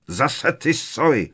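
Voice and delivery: high, drawn-out exasperated roar; acted angry outburst. Uncle slamming the window on owls, angry outburst. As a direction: rage slowly, word by word.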